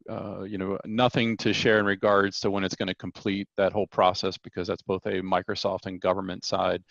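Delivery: usual speaking voice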